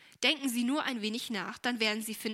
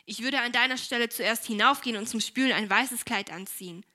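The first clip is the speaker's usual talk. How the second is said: curt and louder, a touch cross; unfriendly tone; 8 dB louder than usual. A narrator's reading. Esther corrects her careless sister, louder and unfriendly. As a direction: scold her sister with a firm edge. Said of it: "in keineswegs freundlichem Ton".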